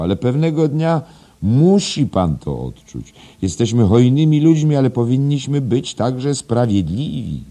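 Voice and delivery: high, loud, ringing exhortation; cheerful. A narrator's reading. Headmaster exhorting the indebted teacher, cheerful and loud. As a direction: preach it cheerily with full voice. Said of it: "powiedział wesoło".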